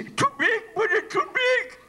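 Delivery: high, garbled talk through teeth too big; oversized false teeth. Acted. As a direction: squeak it past a mouthful of huge dentures. Speaker with oversized dentures.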